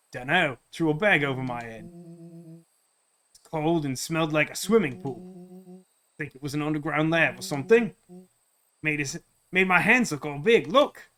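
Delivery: put-on accented character voice, casual recounting; grown-up Rollo. Rollo telling Luca about the underground lab, accented recounting. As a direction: recall it offhand in the big friend's accent.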